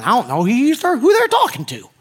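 rising to a high, puzzled squeak; lightly voiced bewildered Jesus; confused Jesus. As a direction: play Jesus baffled by the English name. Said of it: "He's not been having going".

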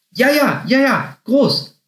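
very high, squeaky little chorus; excited rabbits calling. A narrator's reading. Two rabbits chime in while eating, calling excitedly. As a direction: chime in eagerly in a high bunny voice. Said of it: "riefen die zwei anderen Kaninchen mit vollen Mündern".

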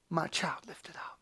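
low, whispered assurance; barely audible, reverent murmur; hushed. Acted. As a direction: whisper it tenderly, fading away. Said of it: "In the spirit that cries out".

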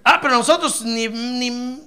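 ordinary delivery

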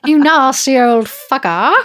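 put-on laughing, high and loud old voice; old person voice. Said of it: "I just become an old person. That's my accent".

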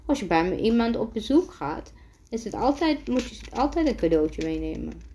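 plain reading voice

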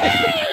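very high, loud mock shriek; hammed-up horror-movie scream. Imitating her fleeing the supposed zombie, mock scream.

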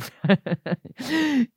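a loud, open burst of real laughter. Real, not acted. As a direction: let a big laugh out at the photo. Laughing at the photos.

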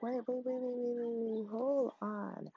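high, soft rapid waits; in disbelief, stop everything; stunned. Retold or imitated. Stunned realization, retold quote.

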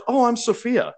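lightly voiced, airy self-introduction; Sophia's voice.

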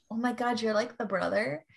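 normal talking voice

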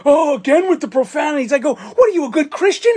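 put-on high, sneering old-man voice; piping mock-indignant old man. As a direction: mimic the prudish old guy scornfully. Mocking the old man's prudishness.

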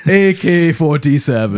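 put-on slow Russian accent; heavy mock Russian; fake Russian accent. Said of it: "I only speak Russian now".

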